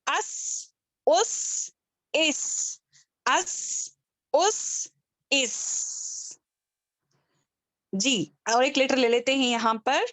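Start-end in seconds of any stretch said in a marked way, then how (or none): 0.1-6.4 اس said higher with a long whistling hiss on the s. Shown as a demonstration. Teacher demonstrating the whistle of seen. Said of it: "سین جو ہے تین حرفوں والا ہے".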